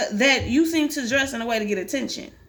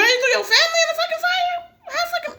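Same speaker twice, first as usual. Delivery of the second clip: much higher, stunned question; shocked. Real, not acted. Gamer shocked the girl burned her family, high disbelief. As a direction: squeal it in disbelief.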